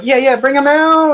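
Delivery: high rising crowd call; excited showman's holler; hype-man announcer. Acted. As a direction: call the fighter out high, stretching the end. GM voices milkman calling fighters.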